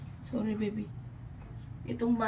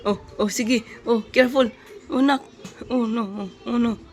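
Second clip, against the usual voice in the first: alarmed calls; 9 dB louder than usual. Real, not acted. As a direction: call out in sudden alarm. Baby toddling off, mother alarmed. (sigaw sa pag-aalala)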